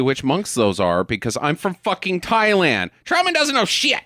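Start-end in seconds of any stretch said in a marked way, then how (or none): 3.0-4.0 high-pitched, exasperated cry; hammed-up exclamation; mock indignant outburst. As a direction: pitch leaps up in comic disbelief. Mock outburst as a Thai local.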